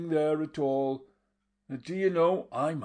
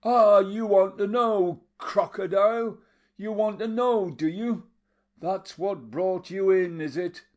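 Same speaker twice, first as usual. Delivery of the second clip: 1 taunting fury; 8 semitones higher, 7 dB louder than usual. A narrator's reading. Mattia taunting while hitting him.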